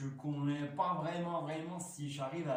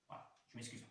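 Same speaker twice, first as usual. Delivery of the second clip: whispered apology; 12 dB quieter, much faster than usual. Real, not acted. Apology after botched English.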